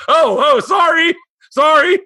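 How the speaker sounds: high, loud flustered apologizing; embarrassed dad squealing sorry at his daughter; panicked apology. Retold or imitated.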